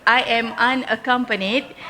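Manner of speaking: normal talking voice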